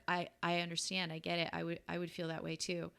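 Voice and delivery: put-on soft, gentle reassurance to an upset child; softening voice of a calm, understanding parent; reassuring. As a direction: soothe her quietly, on her side.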